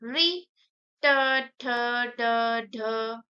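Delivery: ट, ठ, ड, ढ with tongue curled back; spaced-out recital of री, ट, ठ, ड, ढ; retroflex letters demonstrated. Shown as a demonstration. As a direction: sound out री, ट, ठ, ड, ढ one at a time. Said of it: "इसको बोलने पे जीभ आपकी मुड़ जा रही है".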